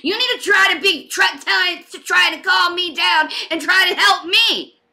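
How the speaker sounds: impassioned angry scolding. Real, not acted. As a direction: raise your voice in outraged protest. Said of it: "I'm raising my voice".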